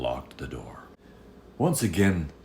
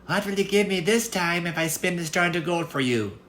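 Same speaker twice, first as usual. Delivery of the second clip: much higher, louder squeaky imp voice; a sly little goblin bargaining; impish little man; 13 semitones higher, 4 dB louder than usual. Acted. Little man bargains with the girl, high and impish.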